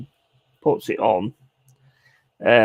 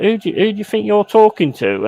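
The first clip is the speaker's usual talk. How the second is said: higher-pitched indignant retort; 9 semitones higher, 4 dB louder, faster than usual. Retold or imitated. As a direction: mimic an offended customer squaring up. Angry customer in retold quarrel.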